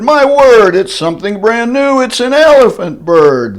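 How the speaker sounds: high, loud amazed cry; shouted. Acted. Cheering crowd at the elephant bird, high loud amazement. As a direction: cry out in wonder. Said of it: "they shouted".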